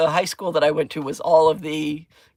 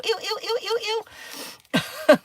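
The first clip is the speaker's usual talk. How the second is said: disgusted ew ew; faster than usual. Retold or imitated. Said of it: "make my fingers go".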